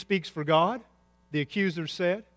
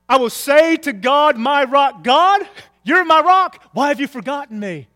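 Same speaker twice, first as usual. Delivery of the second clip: loud, high cry to God; impassioned plea; 9 semitones higher, 11 dB louder than usual. Acted. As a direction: call out to God, then drop on the question. Acts out psalmist's anguished prayer.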